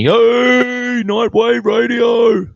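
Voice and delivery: high, loud, slow shout-out; big stretched-out radio shout; calling out. Real, not acted. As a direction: hail the viewer like a radio host. Calls out to a viewer.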